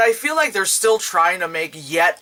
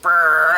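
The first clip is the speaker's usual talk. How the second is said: game buzzer noise; 7 dB louder than usual. Retold or imitated. Imitating the Simon game's "no" buzz.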